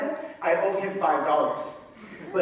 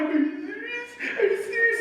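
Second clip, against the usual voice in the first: mock whiny kid. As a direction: mimic the kid's whine, laughing. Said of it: "I'm like".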